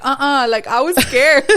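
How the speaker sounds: high laughing admission. Real, not acted. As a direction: laugh while confessing fear. Laughing at own fear.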